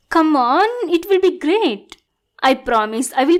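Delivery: eager coaxing. Acted. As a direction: coax them with rising, pleading eagerness. Villain coaxing hero into date.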